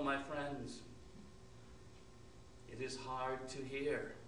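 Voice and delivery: soft, low solemn narration; hushed storytelling. A narrator's reading. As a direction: draw the listeners in quietly.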